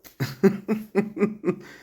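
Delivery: loud high burst of laughter; laughing out loud. Real, not acted. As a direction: crack up at the joke. Laughing at own joke.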